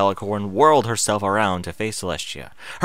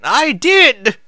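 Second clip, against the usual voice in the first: stern; 14 semitones higher, 10 dB louder than usual. Acted. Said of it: "Sage said sternly".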